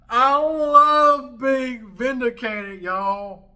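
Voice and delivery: triumphant shout. Real, not acted. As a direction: crow it to the whole audience. Gloating after clip.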